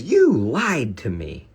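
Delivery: slow, drawn-out put-on voice for the date; accusing lady. Date calls him out across the restaurant, slow accusing voice. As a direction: lay the accusation on slowly.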